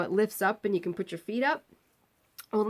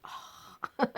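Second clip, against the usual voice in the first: soft, breath-only "oh"; sigh of relief; 9 dB quieter than usual. Retold or imitated. Patient hears the screamer finally go quiet, sigh of relief. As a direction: let out a long relieved breath.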